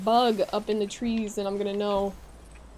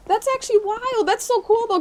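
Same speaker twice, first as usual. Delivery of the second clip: high-pitched excited wonder; amazed enthusiasm; 11 semitones higher, 3 dB louder than usual. Real, not acted. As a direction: gush with real amazement. Amazed by viewer's caffeine fact.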